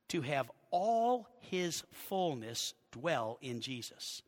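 low, soft, drawn-out phrases with pauses; slow scripture reading. Real, not acted. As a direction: linger over the verse word by word. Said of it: "I'll read it slowly".

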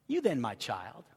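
low, soft fatherly address; hushed tenderness toward a child; tender. Acted. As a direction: say it gently, like to a beloved son. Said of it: "how tender they are".

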